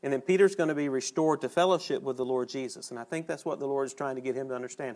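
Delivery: ordinary talk, nothing marked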